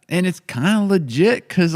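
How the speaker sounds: usual speaking voice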